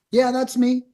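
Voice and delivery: lightly voiced property owner answering the phone; seller answering. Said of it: "So here's our script".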